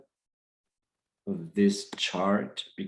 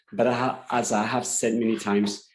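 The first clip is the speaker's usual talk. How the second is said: normal talking voice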